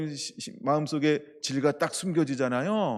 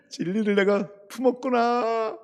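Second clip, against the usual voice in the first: higher, slow, marvelling; mock joyful realization; 8 semitones higher, 3 dB louder, slower than usual. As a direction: savour the discovery with hammed-up delight. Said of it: "좀 더 기쁘게 해야 되는데".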